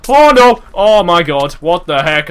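loud high panic; panicked shout. Real, not acted. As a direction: yell in sudden alarm. He is poisoned.

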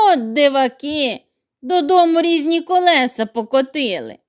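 ordinary delivery, nothing marked

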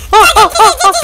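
loud high laughter; shouting laugh. Real, not acted. Laughing in chaos.

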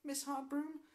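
schoolgirl voice. Acted. Pupil answering the teacher.

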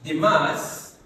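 dictating word by word with long pauses; teacher dictating a question, long gaps between words; dictation. Acted. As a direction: spell it out for students writing along.

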